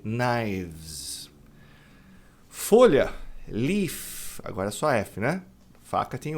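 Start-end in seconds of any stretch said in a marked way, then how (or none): 0.0-1.3 "knives" ending in a buzzing vz; "knives" with the last s voiced as z; final z sound. Shown as a demonstration. Teacher stresses the z ending of "knives". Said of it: "do V vai pro Z".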